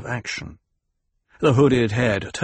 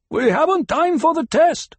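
high, loud protest from above; strained-pitch objection, called out; 5 dB louder than usual. A narrator's reading. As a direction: protest urgently across the rocks. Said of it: "the voice above them protested".

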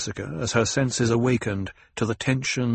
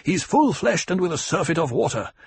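angry retort; 8 semitones higher, 4 dB louder than usual. A narrator's reading. Jameis angrily argues.

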